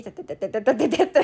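quick tapping footstep noise; light pitter-patter of running feet. Retold or imitated.

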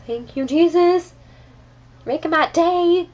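high, loud, drawn-out gushing; acted-out elated praise. Churchgoer reacting to a worship singer, in exaggerated elation. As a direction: call out thanks, stretched and high.

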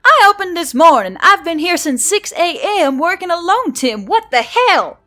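high, loud furious protest; angry outburst. A narrator's reading. Barista protesting the double shift, furious shout. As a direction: shout it at your boss.

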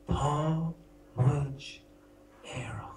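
"Tá muid éireach" whispered softly and tenderly; whispered Irish. Shown as a demonstration. Comic presenting the one lovely Irish sentence, whispered. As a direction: breathe "Tá muid éireach" like a lullaby. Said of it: "the only nice sentence in the Irish language".

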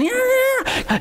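squealed nonsense chatter, very high; high-pitched babble. Retold or imitated. Preacher imitating Greek phone talk between daughter and mom, high-pitched. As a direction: mimic a shrieky phone conversation without real words. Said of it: "high pitch".

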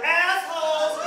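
high singled-out retort, called across the street; drawn-out call. Retold or imitated. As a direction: throw it back as a high call. Repeats his call for laughs.

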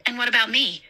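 a touch of strain in the voice; tight voice. A narrator's reading. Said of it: "in a tight voice".

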